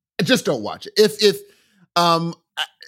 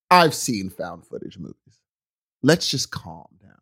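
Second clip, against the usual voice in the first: mock calm self-talk; 8 semitones lower than usual. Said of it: "And I said".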